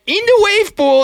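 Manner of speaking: high mocking squeal of the stranger's line; mock excited squeal. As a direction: squeak it out like a giddy parody. Mocking the guy's excited suggestion.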